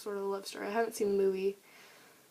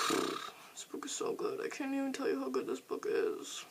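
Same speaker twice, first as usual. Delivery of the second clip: breathy whisper; 3 semitones higher, 5 dB quieter than usual. Real, not acted. Gushing about a beloved book.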